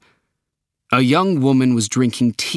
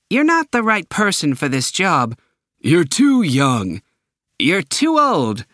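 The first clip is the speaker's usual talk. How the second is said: a hint of a string of bored interviewers, higher; interviewers rejecting him; 6 semitones higher than usual. Series of interviewers rejecting Sam.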